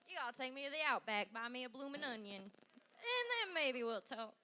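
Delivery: put-on terrible hillbilly accent. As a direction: lay on a bad hillbilly accent. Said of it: "That's a terrible hillbilly accent".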